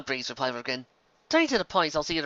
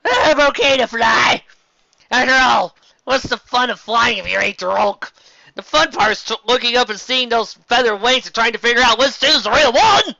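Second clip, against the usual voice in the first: tipsy patron voice; 8 semitones higher, 7 dB louder than usual. Acted. Drunk pony leaving the tavern.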